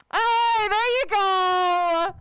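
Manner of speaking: high, drawn-out whooping shout; long, loud celebratory holler; loud cheer. Real, not acted. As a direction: cheer them on at full volume. Cheering someone on in game.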